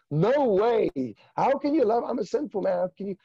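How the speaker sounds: high-pitched, incredulous disbelief; freaking out. Retold or imitated. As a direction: protest in retold astonishment, pitch jumping up. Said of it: "now I'm freaking out".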